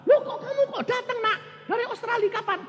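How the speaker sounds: put-on high, quick, delighted old woman; surprised old mother. Mother surprised her son came from Australia, high. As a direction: greet the son in a squeak of surprise.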